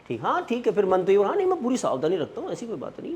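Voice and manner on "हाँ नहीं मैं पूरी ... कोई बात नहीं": higher, quick and soft; hurried little promise to oneself; glib self-reassurance. Acted. The mind assuring the person it will be careful, glibly reassuring.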